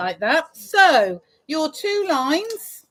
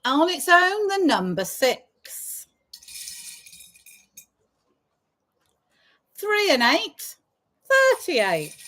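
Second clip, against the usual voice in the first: sing-song bingo number calls; drawn-out call then quick repeat; bingo caller; slower than usual. Acted.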